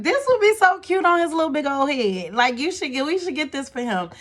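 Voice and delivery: high, bright retelling of her own shopping glee; excited gushing. Retold or imitated. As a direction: gush over a cute gift. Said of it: "So I'm like".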